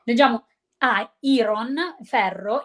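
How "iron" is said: IRON read as spelt, with a sounded "ron"; spelling pronunciation. Shown as a demonstration. Teacher showing Italians' mistake, IRON read as spelt. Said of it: "il modo in cui è scritta la parola".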